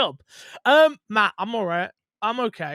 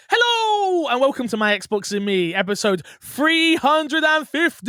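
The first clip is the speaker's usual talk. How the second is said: high, loud, drawn-out announcer welcome; show intro shout; 8 semitones higher, 7 dB louder than usual. Real, not acted. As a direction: belt out the episode opener, stretching the last word. Show opening announced with big energy.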